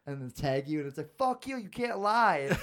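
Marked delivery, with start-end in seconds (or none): 1.2-2.6 high, loud and slow yell; indignant yell. Retold or imitated. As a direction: call him out, loud and drawn out. Yelling at a lying kid.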